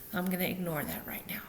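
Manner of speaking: quick whispered aside under the breath. Real, not acted. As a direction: whisper it fast, half to yourself. Aside about cat on counter.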